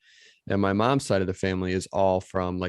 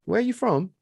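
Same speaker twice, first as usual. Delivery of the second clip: imitated British man, higher-pitched; British accent; 9 semitones higher than usual. Co-host imitating a cinema customer asking, British accent. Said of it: "he had a British accent".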